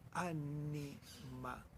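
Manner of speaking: ánima said very slowly and softly, each vowel stretched; soft drawn-out vowels. Shown as a demonstration. Poet explaining soft vowels, savouring ánima slowly. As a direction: breathe ánima out gently, lingering on every vowel. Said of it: "la palabra ánima empieza con una vocal suave".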